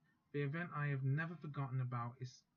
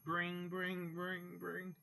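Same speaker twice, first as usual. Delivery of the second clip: alarm imitation; 5 semitones higher, much slower than usual. Imitating the alarm clock ringing.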